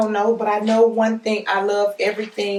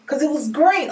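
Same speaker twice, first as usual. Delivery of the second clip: higher, louder triumphant I knew it; excited I knew it; 3 semitones higher, 3 dB louder than usual. Real, not acted. Host to viewers after guessing the oil right, excited. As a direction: gloat happily at guessing right. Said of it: "let me calm down".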